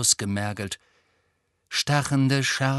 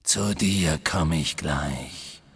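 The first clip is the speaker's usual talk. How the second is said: low menace, rising at the end; cold dark lord, contemptuous; 6 semitones lower than usual. A narrator's reading. Dark lord dismisses his servant's whining apology, low menace.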